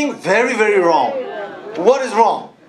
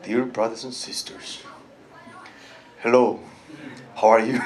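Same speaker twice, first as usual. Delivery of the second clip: much lower and softer, barely speaking; softly spoken; 14 semitones lower, 7 dB quieter than usual. Shown as a demonstration. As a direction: greet them in a hushed, gentle murmur. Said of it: "softly spoken".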